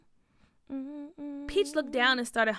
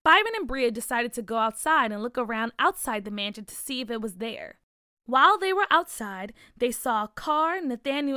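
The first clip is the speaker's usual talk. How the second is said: plain reading voice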